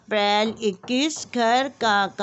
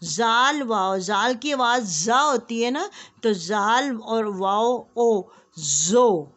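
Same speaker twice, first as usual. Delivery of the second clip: phonics drill; slower than usual. Shown as a demonstration. Teacher drilling KG class on joining letters with वाव. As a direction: sound out each letter pair for small children. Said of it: "इसी तरीके से ये है जाल और ये है वाव".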